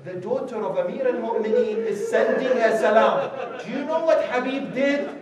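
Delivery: high, loud, impassioned sermon delivery; loud, high climax of a sermon; full-force preaching. Acted. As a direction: preach it out to the whole hall.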